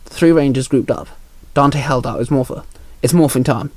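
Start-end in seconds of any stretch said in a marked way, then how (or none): none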